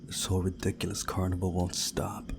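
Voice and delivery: ordinary talk, nothing marked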